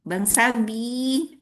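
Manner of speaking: slow, lilting call for someone; calling out a name. Shown as a demonstration. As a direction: sing out the name slowly, rising at the end. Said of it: "saya panggil ada Bang Sabi".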